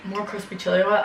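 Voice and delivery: usual speaking voice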